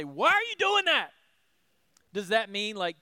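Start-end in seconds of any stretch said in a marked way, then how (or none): 0.0-1.1 put-on loud, high scolding of a toddler; exasperated parent. A parent scolding a toddler caught making a mess, loud and exasperated. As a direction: snap it at a misbehaving child, loud and high. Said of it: "had a toddler and you say".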